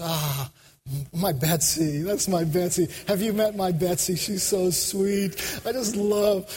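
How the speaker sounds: tender pride, drawing out the sweetness; adoring Jesus. Acted. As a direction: gush fondly, as if showing off a loved one. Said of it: "Jesus just goes".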